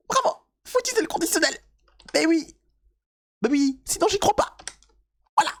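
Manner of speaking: very high mocking praise; sarcastic falsetto. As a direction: sneer it in falsetto.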